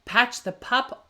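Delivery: ordinary delivery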